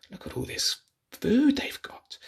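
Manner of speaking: soft unvoiced whisper; whispered aside. Reader whispering to children over the picture. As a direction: whisper it in awe.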